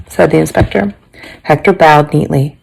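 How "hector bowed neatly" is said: usual speaking voice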